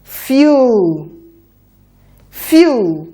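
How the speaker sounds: quick, joined-up "Fuel". Shown as a demonstration. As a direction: run the sounds of "Fuel" together fast. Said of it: "A bit faster now".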